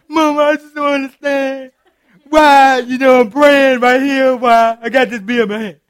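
acting out a tipsy daughter crying out to her mama; mock tipsy daughter calling mama. Daughter at the club calls her mama, mock loud tipsy plea.

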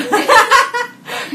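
high, loud burst of laughter; sudden high cackling laugh; big laugh. Real, not acted. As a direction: crack up loudly at your own joke. Laughs at own single status.

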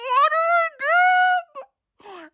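very high, drawn-out panicked squeal; mock panic. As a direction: squeal it slowly in comic panic. Retold panic mid cover story.

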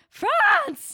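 very high, loud mock shriek; pageant contestant's shout. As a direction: imitate an over-keen pageant introduction yell. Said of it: "What did she say?".

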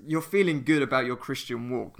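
ordinary delivery

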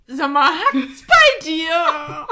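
loud, slow, laughing disbelief; half-laughing indignant yell; imitating a friend's loud outrage; mock outraged friend. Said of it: "sie guckt mich an und sagt so".

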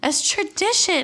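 gleeful agreement. Real, not acted. Gleefully echoing the friend.

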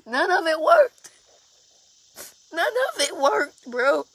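high, loud anguished outburst. Real, not acted. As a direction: let the hurt burst out. Distressed retelling of assault.

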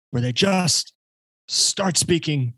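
slow, high mock preacher voice, projecting; a hint of a booming Southern preacher; preacher mode. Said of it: "Southern preacher mode".

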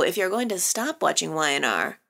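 usual speaking voice

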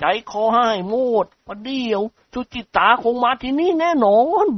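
high, jittery frightened servant; a panicky servant stammering out his story; Haew's voice. A narrator's reading. Haew voiced by narrator. (เสียงแห้วตื่นกลัวลนลาน)

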